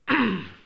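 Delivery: loud dry throat clear. Real, not acted. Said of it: "that Fronto's making my throat dry out".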